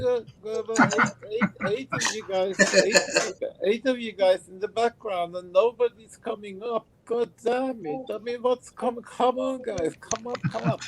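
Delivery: squeaky exasperated complaint; high mock voice.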